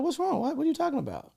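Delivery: mock woman voice. Man imitating the mother playing innocent, high mock voice. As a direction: squeak her innocent reply. Voicing her innocent reply.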